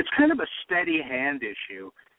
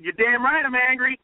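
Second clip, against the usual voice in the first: high, louder retold angry declaration; Trump's defiant retort; 10 semitones higher, 4 dB louder than usual. Fan retelling Trump's debate answer, defiantly angry. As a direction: imitate a proud, defiant candidate answering back.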